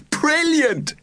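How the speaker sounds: put-on high sighing kid voice; a breathy, delighted sigh; sighing child. A narrator's reading. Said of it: "sighed another".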